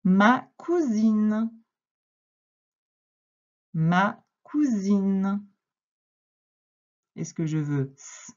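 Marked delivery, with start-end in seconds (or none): 0.0-1.5 very slow word presentation, louder; slow dictation. Shown as a demonstration. Teacher announcing a word to a pupil, slow dictation. 3.7-5.4 slowly repeated word, spaced apart; slow dictation. Shown as a demonstration. Teacher repeating the word for a pupil, slow dictation.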